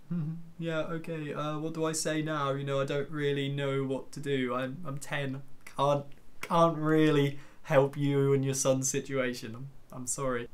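soft, hesitant thinking-to-himself voice; awkward inner thought. Retold or imitated. Ten-year-old unsure how to help a grieving lady, quietly awkward. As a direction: think aloud quietly, imitating a child out of his depth.

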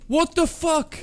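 high, loud startled shout; shocked yell. Real, not acted. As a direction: blurt it out in shock. Sudden shock in game.

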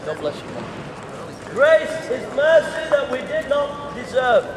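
ordinary talk, nothing marked

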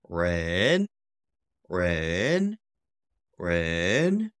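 long REN climbing from the bottom; third tone. Shown as a demonstration. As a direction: draw out REN and lift the end. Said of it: "Third tone also up and down".

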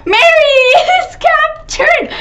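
high, drawn-out cry of dismay; long panicky squeal; alarmed wail. Real, not acted. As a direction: wail the bad news at high pitch. Teammate gets caught in game.

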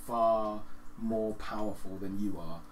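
ordinary talk

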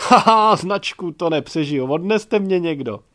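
loud laughing through the words. Acted. Gajdošík cracking up at the story, laughing. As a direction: laugh yourself silly.

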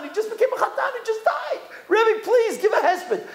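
very high, insistent begging; pleading. Retold or imitated. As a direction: act out a desperate plea to the rabbi. Mourners beg rabbi for eulogy.

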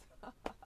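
short breathy chuckle; quick self-amused giggle; laugh. Real, not acted. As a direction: laugh it off briefly. Laughs at her missing mic.

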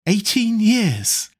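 high, slow, gloating disbelief; elated, relieved exclamation; triumphant exclamation. A narrator's reading. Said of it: "laughed Dorian Gray with a touch of triumph".

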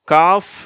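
காஃப் said loud and high with no L sound; silent L. Shown as a demonstration. Teacher showing a spelling rule, காஃப் with silent L. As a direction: say காஃப் as a long vowel straight into F. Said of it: "இங்க வந்து silent ஆயிடுது".

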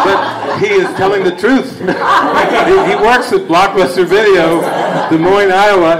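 tickled by his own story; laughing. Real, not acted. Speaker retells the movie joke to his audience, laughing.

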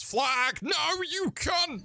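very high, thin scream at the game; sudden high-pitched outburst mid-sentence; panicked shriek. Real, not acted. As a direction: shriek the curse in falsetto. Game goes wrong mid-sentence.

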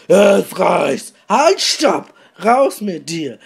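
loud, high furious yell; screaming. Acted. Dieter throwing Detlef out, loud high furious screaming. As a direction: scream him out of the room. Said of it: "Dieter schrie".

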